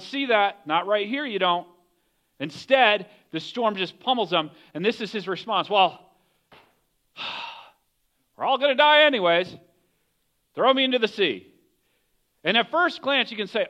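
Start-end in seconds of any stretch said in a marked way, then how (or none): 5.7-11.5 louder, higher sighing exasperation; angry resignation. Acted. Jonah answering the sailors in the storm, angry and resigned. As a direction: throw it out with a fed-up sigh. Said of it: "He's angry".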